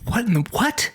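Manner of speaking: Moses whispering back, baffled; hushed mock shock, breaking briefly into a high squeak; whispered disbelief. Moses reacting to God's whispered tip, whispered disbelief. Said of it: "And Moses is like".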